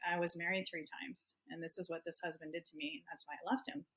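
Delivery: mildly choked, hushed admission; retelling a confession through tears, softly; retold tearful confession. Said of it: "And I just started crying".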